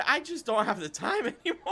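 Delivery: laughing while talking; words broken by a laugh. Real, not acted. Laughing through admission about time.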